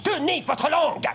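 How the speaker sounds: stern scolding. Acted. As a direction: lay down the law. Teacher scolding student.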